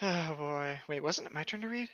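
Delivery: usual speaking voice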